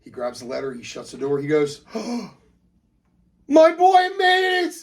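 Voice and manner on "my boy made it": very high, loud celebration; squealing triumphant shout; jubilant dad. Acted. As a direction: whoop with a proud father's overjoyed disbelief. Dad celebrating son's acceptance letter.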